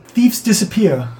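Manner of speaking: put-on ominous little boy. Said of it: "He says".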